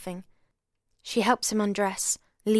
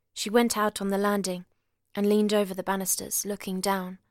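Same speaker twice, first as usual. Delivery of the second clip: usual speaking voice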